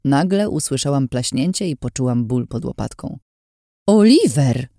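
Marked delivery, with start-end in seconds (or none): 3.9-4.7 loud, high indignant cry of his name; shout. A narrator's reading. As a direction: yell at him after the hit. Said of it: "krzyknęłam do przyjaciela". (głośny, oburzony okrzyk)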